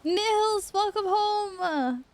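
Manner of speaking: high, drawn-out cheery welcome; excited greeting. Real, not acted. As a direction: call out a delighted hello. Greets friend arriving in group.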